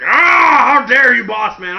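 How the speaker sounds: high, loud outraged cry; mock rage. Big Show raging at his boss, shouting. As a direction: howl it like a betrayed giant. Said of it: "he's raged".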